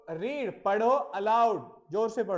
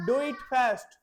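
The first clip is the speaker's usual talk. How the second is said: urging command; 3 semitones higher, 5 dB louder, much slower than usual. Shown as a demonstration. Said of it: "हमें इसे कैसे बोलना है".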